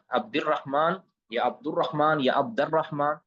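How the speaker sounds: عبد الرحمن said three times with a different vowel after عبد each time; teacher sounds out each vowel reading of عبد الرحمن in turn. Shown as a demonstration. To students, the teacher demonstrates three readings of the name. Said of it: "تینوں اعراب صحیح".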